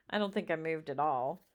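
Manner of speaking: plain reading voice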